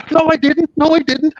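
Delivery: high, pleading denial; protesting denial. Retold or imitated. Accused player denying to the dealer, high protest. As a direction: imitate the old man's frantic protest. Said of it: "He was going".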